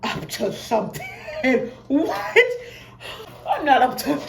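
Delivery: loud, high, laughing protest; laughing denial. Retold or imitated. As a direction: laugh off the accusation.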